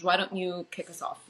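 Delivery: normal talking voice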